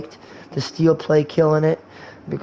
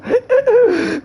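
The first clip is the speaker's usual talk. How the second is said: loud burst of laughter; 3 dB louder than usual. Real, not acted. Laughs at own joke.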